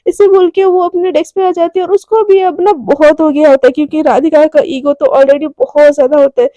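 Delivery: ordinary delivery, nothing marked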